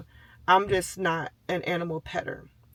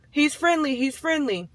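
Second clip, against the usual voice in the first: flustered apology; 7 semitones higher, 4 dB louder than usual. Retold or imitated. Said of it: "She's like".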